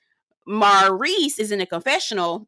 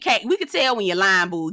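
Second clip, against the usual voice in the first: ordinary delivery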